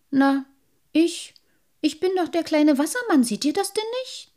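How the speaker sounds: high, halting and surprised; flustered little sprite. A narrator's reading. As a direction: stammer out who you are. Sprite explains himself.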